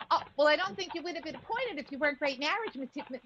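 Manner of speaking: ordinary talk, nothing marked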